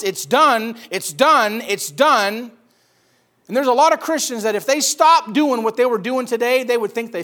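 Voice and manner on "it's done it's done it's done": loud preached proclamation; proclaiming. Acted. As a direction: ring it out from the pulpit. Preaching the cross's answer.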